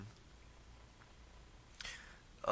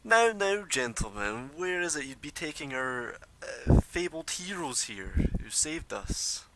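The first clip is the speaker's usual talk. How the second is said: high, slick, theatrical schemer; smooth stranger voice; 5 semitones higher, 8 dB louder than usual. Acted. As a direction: greet them like a sly mediator. GM voices hooded NPC.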